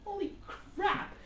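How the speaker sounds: high, amazed holy crap; stunned exclamation. Retold or imitated. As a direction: relive the shock of finding him. Thinking back to meeting a fellow Kuwaiti.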